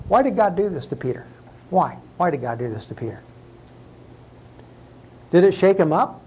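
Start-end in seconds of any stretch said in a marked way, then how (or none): none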